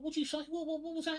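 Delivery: high-pitched mock disbelief; shocked barmaid. Barmaid questioning the posh gent in a joke, mock shock. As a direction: sputter it high, as an affronted barmaid. Barmaid reacts to cheeky customer.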